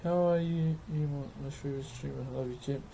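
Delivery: ordinary talk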